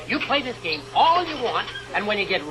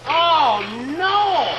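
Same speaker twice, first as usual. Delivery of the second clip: dismayed cry; 4 semitones higher, 5 dB louder than usual. Acted. Discovers broken machine.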